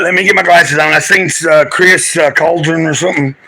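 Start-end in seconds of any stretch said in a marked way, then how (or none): none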